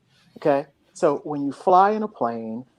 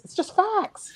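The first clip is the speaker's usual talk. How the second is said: much higher exasperated repeat of facts; pitched up, exasperated with flat earthers; high emphatic repeat; 14 semitones higher, 3 dB quieter, slower than usual. Real, not acted. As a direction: push it up in exasperation.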